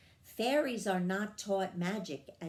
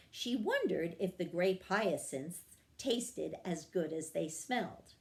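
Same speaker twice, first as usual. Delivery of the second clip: plain reading voice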